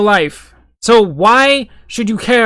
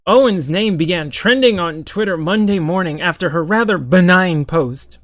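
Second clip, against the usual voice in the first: ordinary talk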